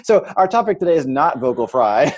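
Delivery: laughing through the words. Real, not acted. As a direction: chuckle while talking.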